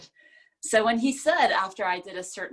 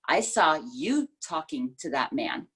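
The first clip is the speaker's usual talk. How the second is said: "you" stressed and slowed, accusing; 4 semitones lower than usual. Shown as a demonstration. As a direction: hit "you" hard, slowly. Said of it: "it's all about you talking".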